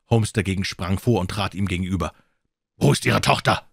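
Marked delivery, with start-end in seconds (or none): 2.8-3.7 stern demand. A narrator's reading. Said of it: "fragte er".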